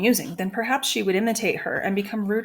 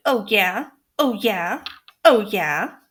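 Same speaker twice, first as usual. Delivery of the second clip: mocking parrot; 4 dB louder, slower than usual. A narrator's reading.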